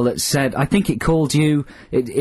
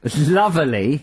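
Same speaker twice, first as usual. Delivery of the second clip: bad British accent; 3 semitones higher than usual. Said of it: "that's how they talk in England".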